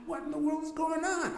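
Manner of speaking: very high, stunned disbelief; bewildered. Retold or imitated. Narrator reacting to the commotion, in high, stunned disbelief. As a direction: ask it like you can't believe your ears. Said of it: "I'm like".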